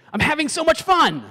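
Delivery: very high, thrilled squeal of a voice; overjoyed party-goer; gushing excitement. Acted. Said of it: "could they be saying".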